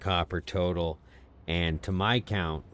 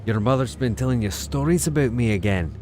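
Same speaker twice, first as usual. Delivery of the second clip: put-on light Scottish accent, a bit raised; 4 semitones higher, 4 dB louder, faster than usual. Streamer quoting Braveheart again, in a light Scottish accent. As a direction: quote it like the Braveheart hero. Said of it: "That's my Scottish accent".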